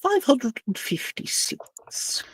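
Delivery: awed whisper of the number. Real, not acted. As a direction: breathe the number in disbelief. GM repeats roll in awe.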